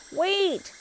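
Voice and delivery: high, loud call after the robot. A narrator's reading. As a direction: call him back as he speeds off. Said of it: "calls Pete".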